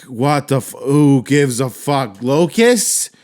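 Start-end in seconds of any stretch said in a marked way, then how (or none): 2.2-3.1 high, loud outrage; exasperated rant, pitched right up; mad. Real, not acted. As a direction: demand an answer, loudly fed up. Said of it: "I'm so fucking mad".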